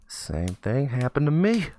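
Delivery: slow line climbing high and loud at the end; homeless man's revelation. Acted. Homeless man to shocked kid sharing the same fate, drawn-out revelation. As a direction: draw it out, rising toward the last word.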